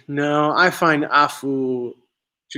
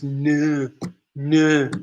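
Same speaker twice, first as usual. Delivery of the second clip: imitating a Hull accent's fronted 'no'; 'no' with a thick Hull vowel, closer to 'nuh'. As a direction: say 'no' as a coworker from Hull would. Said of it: "he says no".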